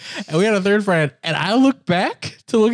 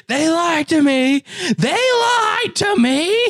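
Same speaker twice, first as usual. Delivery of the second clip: slow, rising, much higher yell building; yelled accusation; 12 semitones higher, much slower than usual. Retold or imitated. Shouting at lying friends as the coaster photo flashes, slow rising yell. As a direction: wail it out like you've been betrayed. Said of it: "I just yell out".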